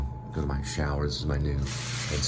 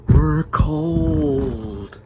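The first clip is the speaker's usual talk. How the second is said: high, loud whiny "We're cold"; mom and sister whining; 13 semitones higher, 7 dB louder than usual. Retold or imitated. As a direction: whine it like a cold family member. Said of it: "they would say".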